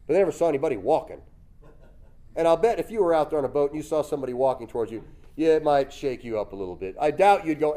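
usual speaking voice

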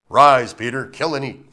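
loud booming Rise, then command; voice of God. Acted. God commanding Peter a third time, loud. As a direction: call down the order. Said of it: "Three times".

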